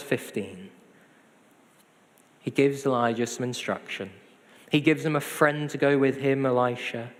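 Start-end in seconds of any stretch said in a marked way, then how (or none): none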